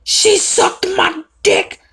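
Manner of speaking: high, slow mocking rap voice; mocking rapper. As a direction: parody the mainstream rapper, drawn out. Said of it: "everyone focuses on the mainstream".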